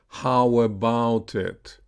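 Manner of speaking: slow "How about it" joined by a w; "How about it" with no break between words; linked w glide. Shown as a demonstration. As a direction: stretch "How about it" as how-wabout it. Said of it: "connected with the off glide U".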